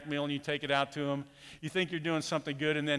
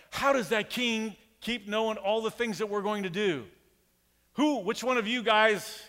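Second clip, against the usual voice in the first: higher, raised voice of a frustrated king; 6 semitones higher than usual. Retold or imitated. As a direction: demand answers like an exasperated ruler. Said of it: "the king of Aram is frustrated".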